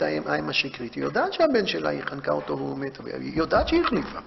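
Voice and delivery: ordinary talk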